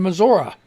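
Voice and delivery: Missouri ending in a relaxed "uh"; local "Missoura". Shown as a demonstration. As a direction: say Missouri like a local, "muh-ZOR-uh". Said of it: "That's how they say it".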